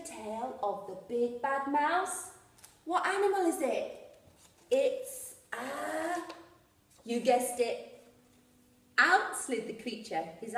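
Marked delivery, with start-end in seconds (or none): none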